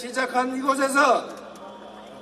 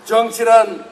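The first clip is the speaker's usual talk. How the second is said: ordinary delivery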